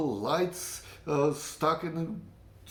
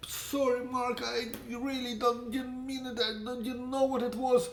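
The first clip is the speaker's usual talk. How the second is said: higher, sheepish apology; Yakov's retold apology, voiced higher; apologetic friend; 9 semitones higher than usual. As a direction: play the friend saying sorry. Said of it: "he was apologetic".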